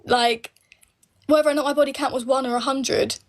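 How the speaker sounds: normal talking voice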